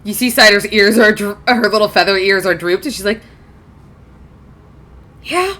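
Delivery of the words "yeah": sad. A narrator's reading. Drooping-eared Cider agrees.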